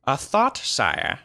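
high, tentative formal opening; suggesting wizard. Acted. Wizard making a suggestion to his sire, deferential. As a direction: float it deferentially to a lord. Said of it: "suggested Grand Alf".

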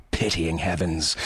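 ordinary delivery, nothing marked

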